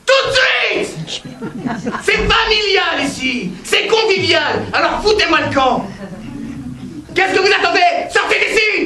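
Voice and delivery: high, slow furious yelling; furious shout. Acted. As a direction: scream them out of the hotel. Clerk yells guest out of hotel.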